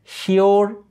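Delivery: "শিওর" as shee-or, high and drawn out; wrong pronunciation shown. Shown as a demonstration. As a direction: stretch the faulty ee glide in "শিওর". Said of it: "হবে না". ("শিওর" ভুল বাংলা ধাঁচে, উঁচু সুরে)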